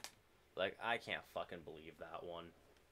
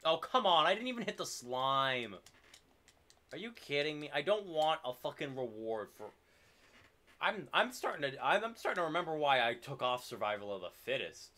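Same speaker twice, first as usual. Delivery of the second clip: higher, louder fed-up ranting; exasperated rant; 6 semitones higher, 6 dB louder than usual. Real, not acted. Player ranting at the game after an unfair hit, higher and louder exasperation. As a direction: vent at the game like it cheated you.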